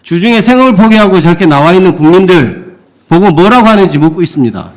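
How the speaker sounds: normal talking voice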